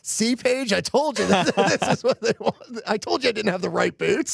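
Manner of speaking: a playful told-you-so with a laugh; mock triumphant. Retold triumphant remark to wife.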